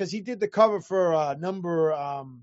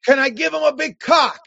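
higher, louder cheeky question; mock eager request; 6 semitones higher, 7 dB louder than usual. Drunk artist asking the editor about the cover, mock eagerness. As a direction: blurt it out loud like an eager artist.

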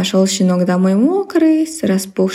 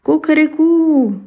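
high, slow rooster crowing; 7 semitones higher, slower than usual. Acted. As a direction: crow it like a rooster. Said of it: "захлопал крыльями и закричал".

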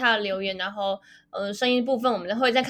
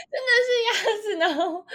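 giggling through the words; laughing; 10 semitones higher than usual. Real, not acted. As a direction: laugh while saying it. Laughs about duck. (笑着说)

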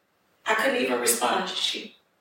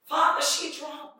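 laughing prayer; 8 semitones higher, 3 dB quieter than usual. Retold or imitated. Praying in disbelief.

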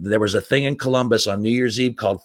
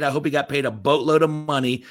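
ordinary talk, nothing marked

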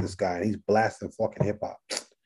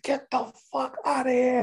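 pitched way up, thrilled and incredulous; excited disbelief. Retold or imitated. Patient finding out his favorite MC helped him, excited disbelief. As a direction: yelp it like you can't believe your luck. Patient realizes therapist is [name].

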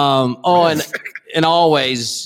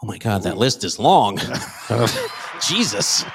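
usual speaking voice

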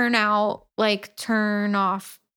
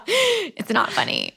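laughing; 8 semitones higher than usual. Real, not acted. Still laughing after laugh.

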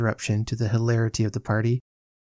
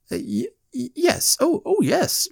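very high, halting, nervous yes; flustered stammer. A narrator's reading. Winkle is asked whether he skates, flustered stammer. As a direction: stammer your way to a squeaky yes.

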